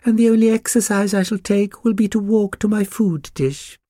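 very high, snooty pug voice; pampered little dog putting on airs; lofty. A narrator's reading. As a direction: boast grandly in a high-pitched character voice. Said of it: "said the Pug loftily".

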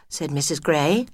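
usual speaking voice

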